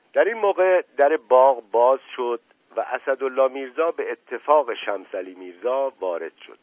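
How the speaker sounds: ordinary delivery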